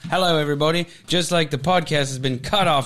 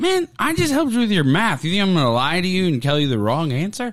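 mock exasperation; 7 semitones higher than usual. Retells exasperated reply to son.

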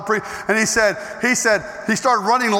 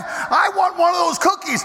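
a put-on voice, calling out that he wants a cookie; greedy craving; 7 semitones higher than usual. Preacher voicing his greedy side, calling out. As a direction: play the grabby inner voice.

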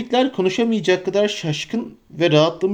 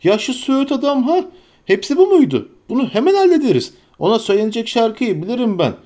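high, fast scolding; scornful Tom Bombadil; 5 semitones higher than usual. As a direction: boast he'll sort the tree out. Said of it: "diye haykırdı Tom Bombadil".

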